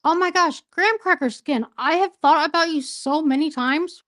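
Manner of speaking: high, loud happy welcome; excited greeting. Real, not acted. Excited greeting of returning friend.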